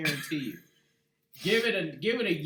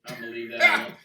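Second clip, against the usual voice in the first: laughing; 5 semitones lower, 10 dB louder, faster than usual. Real, not acted. Laughing at joke.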